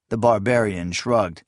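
ordinary delivery, nothing marked

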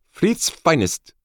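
high, drawn-out woman's voice; proclaiming. A narrator's reading. Woman showing off the vast throng to him, proclaiming. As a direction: proclaim it slowly in a high-pitched character voice. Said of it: "she proclaimed".

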